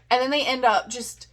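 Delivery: ordinary talk, nothing marked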